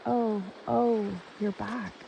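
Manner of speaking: high groaned ohs, softening at the end; groaning. A narrator's reading. Creatures groan along with the queen, mildly groaning. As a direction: echo a mild groan, then fade.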